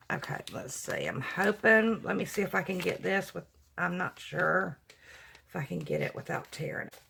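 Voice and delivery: muttering softly while working carefully; half-voiced, focused on the task; hushed concentration. Real, not acted. Working carefully on tearing paper.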